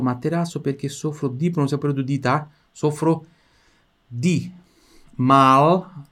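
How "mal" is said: mal with a loud, held final L; clear L in mal. Shown as a demonstration. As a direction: press the tongue for the L in mal. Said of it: "som do L aqui". (mal, com o L bem marcado e forte)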